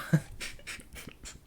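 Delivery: breathy, trailing chuckle; real laugh in light puffs. Real, not acted. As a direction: laugh at your own joke. Amused by own accent joke.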